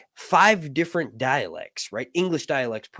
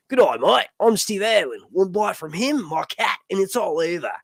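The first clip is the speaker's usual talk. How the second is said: higher-pitched mock Australian accent; Steve Irwin; 7 semitones higher, 3 dB louder than usual. Streamer showing what Australian is something like, in a mock Aussie accent. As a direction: put on a broad, hammed-up Aussie accent. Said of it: "Australian is something like Steve Irwin".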